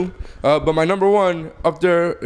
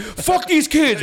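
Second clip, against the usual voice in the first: high, slow hammed-up outburst; mock turned Jedi; 9 semitones higher, much slower than usual. Host mocking how quickly the Jedi turned, hammed-up outburst. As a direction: parody a sudden turn to evil, drawn out. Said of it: "He turned so quickly".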